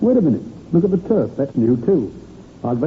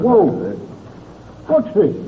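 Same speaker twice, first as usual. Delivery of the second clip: sudden gasp of surprise, then higher; startled find; 6 semitones higher, slower than usual. Acted. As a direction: caught off guard by something in the mud. Finding something while digging.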